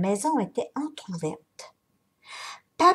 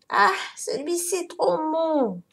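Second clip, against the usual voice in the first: high, whiny little complaint; a girl whimpering in mock disappointment; whining; 7 semitones higher than usual. A narrator's reading. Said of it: "pleurnicha-t-elle".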